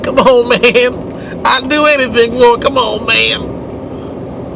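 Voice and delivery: high, dragged-out begging junkie whine; pleading crack fiend. Pretend. Host mocks retailers as fiends, pleading high and slow. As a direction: beg for it like a desperate addict, drawing the words out. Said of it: "you want 'em like crack fiends".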